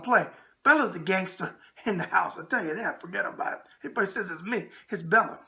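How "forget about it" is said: under breath. Acted.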